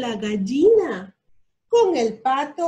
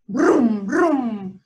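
loud rumbling brum brum; truck engine roar; 5 dB louder than usual. A narrator's reading. Narrator roaring the truck's engine for the child. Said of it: "Bramó el camión de carga".

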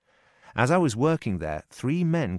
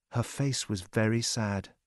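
ordinary delivery

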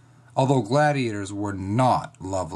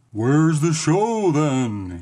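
stretched-out grumble, slightly raised; grumbling god; 3 semitones higher, much slower than usual. A narrator's reading. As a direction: grumble it out slowly as a sky god. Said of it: "he grumbled".

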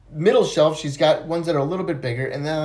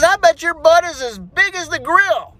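put-on teasing kid; 12 semitones higher, 5 dB louder than usual. Johnny teasing Susie.

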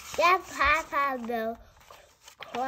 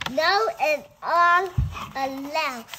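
ordinary delivery, nothing marked

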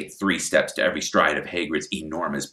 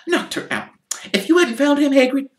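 higher motherly gushing; Mrs Weasley's voice; 7 dB louder, faster than usual. Acted. Mrs Weasley thanking Hagrid, in a higher motherly voice. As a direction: thank him warmly.

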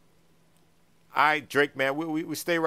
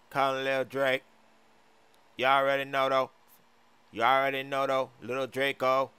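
low, level singsong delivery; 4 semitones lower than usual. Acted. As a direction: drone it out as if crooning. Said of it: "sounds like he's singing or something".

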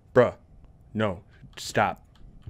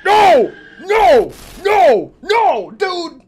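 high, loud repeated no; real shouting; 12 semitones higher, 8 dB louder, much slower than usual. Real, not acted. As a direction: shout each no in desperate protest. Panics at the game.

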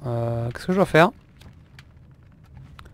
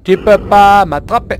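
taunting shout; 7 semitones higher, 13 dB louder, slower than usual. Real, not acted.

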